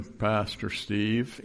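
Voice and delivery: slow, drawn-out imitation of Nace. Pastor imitating Nace's greeting online, slow drawl. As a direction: stretch the name out like Nace. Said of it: "he'd say".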